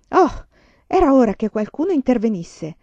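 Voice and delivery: high, loud relieved exclamation; exclaiming. A narrator's reading. Teacher greeting the school board, loud high exclamation. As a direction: burst out as the visitors arrive. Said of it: "esclamò la signorina Wilder".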